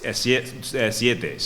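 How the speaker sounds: ordinary talk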